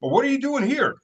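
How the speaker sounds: high, hammed-up foreign protester; squeaky mocking voice. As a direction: imitate the clueless tourist-protester.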